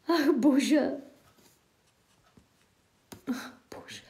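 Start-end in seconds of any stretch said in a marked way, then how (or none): 3.3-4.0 much softer sighed whisper. Acted. Sighed whisper in mock despair at the chat. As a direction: sigh it away to nothing.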